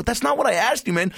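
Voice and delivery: high-pitched exasperated objection; retold protest. Customer telling barber he got it wrong, retold protest. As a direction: protest in mock dismay.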